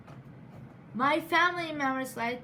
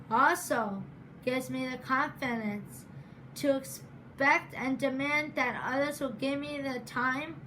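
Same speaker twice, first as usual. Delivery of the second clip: normal talking voice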